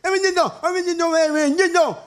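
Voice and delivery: appel crié plus haut, répété; appel en écho. Pretend. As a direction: appelle mi niño comme une voix qui résonne. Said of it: "Y el eco, ¿qué?".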